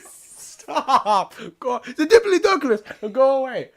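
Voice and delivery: high, loud laughing shout; laughing protest. Real, not acted. Shooing off his friend, laughing and shouting. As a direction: yell it through laughter.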